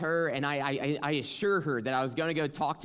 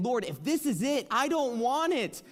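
much higher, louder, anguished plea; desperate prayer; 10 semitones higher, 4 dB louder than usual. Retold or imitated. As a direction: cry out to God in anguish. Retold desperate prayer by bed.

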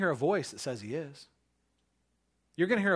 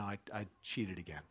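sheepish mumble; 7 semitones lower, 12 dB quieter than usual. Retold or imitated. Sheepish confession to the teacher.

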